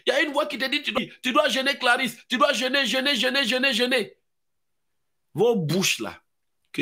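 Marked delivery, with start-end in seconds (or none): 0.8-4.2 higher insistent repetition; urging voice. Retold or imitated. A voice urges Clarisse to fast, higher insistent chant. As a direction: press her to fast.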